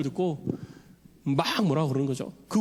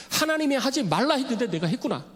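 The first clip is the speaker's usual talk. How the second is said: dismayed realization; 7 semitones higher than usual. Retold or imitated. Paul realizes he cursed the high priest, dismayed exclaim. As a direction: gasp out the realization of a blunder. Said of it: "아이고야, 실수했구나".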